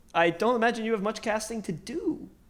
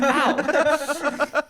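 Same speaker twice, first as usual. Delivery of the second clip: silly clown; 7 semitones higher than usual. Retold or imitated. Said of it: "he's like".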